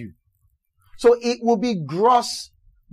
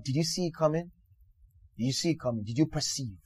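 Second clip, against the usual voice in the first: soft questioning; 4 semitones lower, 11 dB quieter, faster than usual. Retold or imitated. Pastor's gentle probing questions.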